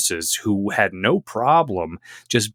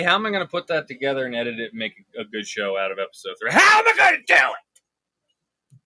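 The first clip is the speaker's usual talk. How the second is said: tantrum shout; faster than usual. Acted. Boss yelling at his cohost about editing the show. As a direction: yell it like a boss losing it.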